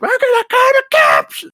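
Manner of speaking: high, loud yelled threat; shrill-pitched mock yell of a threat; mock shout. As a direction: yell it like an angry neighbor. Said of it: "She's like".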